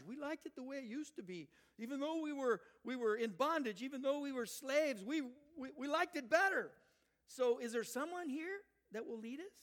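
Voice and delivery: high, loud mock grumbling; hammed-up indignant complaint; complaining crowd. Voicing complaining Israelites.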